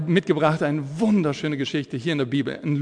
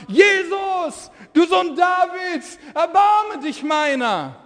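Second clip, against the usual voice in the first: crying out; 12 semitones higher, much slower than usual. Acted. Blind beggar crying out to Jesus, high and desperate. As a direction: call out to Jesus from the roadside, slow and pleading. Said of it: "Und er rief und sprach".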